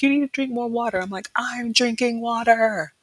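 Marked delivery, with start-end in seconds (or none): 0.0-1.0 higher-pitched mock nagging; retold nagging. Practitioner tells her to drink more, mock nagging. 1.4-2.9 high, slow, drawn-out protest; mock exasperation. Client answers practitioner's nagging about water, mock exasperation.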